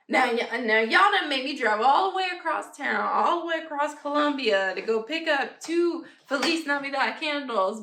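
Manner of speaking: sassy mock outrage; mock exasperated complaint. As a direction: play up being put out by the pointless trek. Mock complaint to store about long drive.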